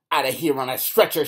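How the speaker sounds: loud threatening yell; fierce yell. A narrator's reading. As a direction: bellow the threat at the bum. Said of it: "she yells".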